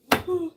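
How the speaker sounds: loud open-mouthed yawn; big drawn-out yawn. Real, not acted. Big yawn mid stream.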